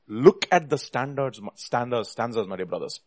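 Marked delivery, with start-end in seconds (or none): none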